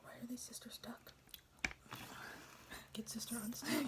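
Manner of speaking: teasing whisper. Pretend. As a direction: whisper it back playfully.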